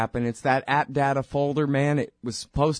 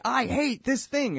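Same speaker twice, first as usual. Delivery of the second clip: mock whine; 12 semitones higher, slower than usual. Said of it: "I say".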